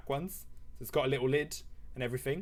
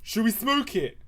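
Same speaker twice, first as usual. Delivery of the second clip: higher-pitched put-on lad voice; chav lad proposing; 7 semitones higher, 4 dB louder than usual. Tyler to his mates about the weed stalk, eager proposal. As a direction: pitch it up, putting it to your mates. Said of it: "And he's like".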